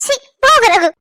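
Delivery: loud playful shove-off; coy scolding. Acted. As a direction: tease him away. Wife teasingly scolding husband.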